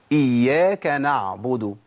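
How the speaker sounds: "إياك نعبد" slowly recited, ع audible; measured "نعبد" with the ع sounded, not over-held; clear ع. Shown as a demonstration. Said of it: "سمعت صوت العين مني؟".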